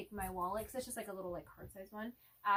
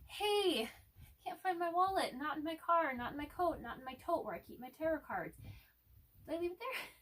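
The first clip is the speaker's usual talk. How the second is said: anxious message; 8 semitones higher, 4 dB louder than usual. Retold or imitated.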